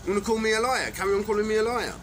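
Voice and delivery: loud, high furious ranting; angry rant. Real, not acted. Ranting at the people he accuses, loud and furious. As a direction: shout the threats at them, fuming.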